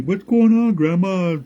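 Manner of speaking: tree waking and asking again; sleepy tree. Acted. Tree repeats question.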